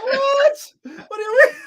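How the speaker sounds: high, loud, laughing disbelief; shocked disbelief. Retold or imitated. Retelling his own shock.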